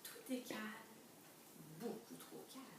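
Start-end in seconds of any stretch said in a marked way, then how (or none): 1.8-2.6 whispered, hushed suspense. A narrator's reading. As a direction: breathe it out as if danger is near. Suspense in read story.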